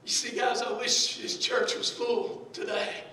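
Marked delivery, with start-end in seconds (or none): none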